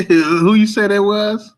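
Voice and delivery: high, slow question through laughter; laughing. Real, not acted. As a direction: ask while still laughing. Laughing at the fan comment.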